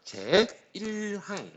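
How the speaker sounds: very slow, drawn-out model reading. Shown as a demonstration. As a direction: stretch out each syllable of the term for students. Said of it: "요렇게 읽어주셔도 무방합니다". (길게 늘여 읽어 보이는 말투)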